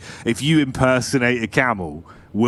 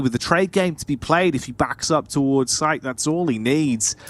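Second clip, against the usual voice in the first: esports play-by-play; 4 semitones higher than usual. Acted. Co-caster play-by-play.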